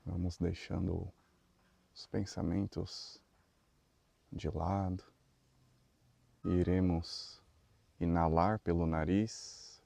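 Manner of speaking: slow, soft meditation voice with long pauses; guided meditation. Real, not acted. Leader guiding the group through breathing and neck stretches, guided meditation. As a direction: lead them gently through each breath, unhurried.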